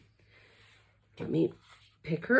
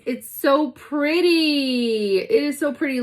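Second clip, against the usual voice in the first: high gushing delight; 7 semitones higher, 3 dB louder than usual. Real, not acted. Gushing over the castle.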